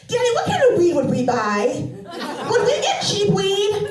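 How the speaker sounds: put-on high, loud little girl asking through laughter; laughing kid voice. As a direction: ask dad like an eager child, cracking up. Daughter quizzing dad, laughing.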